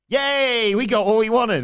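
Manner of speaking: loud, high celebratory yay; gleeful cheer. Acted. Wrestler cheering to his partner as they leave, gleeful shout. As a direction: whoop it out as an over-the-top celebration.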